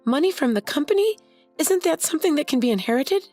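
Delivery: high, incredulous questions; can't believe the money is gone; shocked disbelief. Acted. Mary asking Lucy about the money, high and incredulous.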